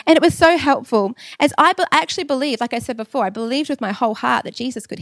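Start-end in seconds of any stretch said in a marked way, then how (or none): none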